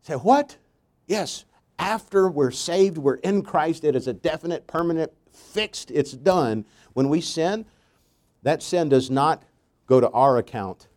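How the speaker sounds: emphatic pulpit delivery, slowed; fervent preaching. Acted. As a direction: preach it slow and strong to the congregation. Preaching at full force.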